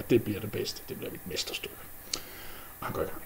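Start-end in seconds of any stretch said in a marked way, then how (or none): 0.0-1.8 gloating whisper. Acted. Dwarf brags secretly.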